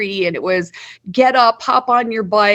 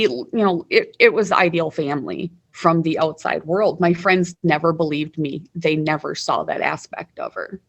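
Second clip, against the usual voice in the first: usual speaking voice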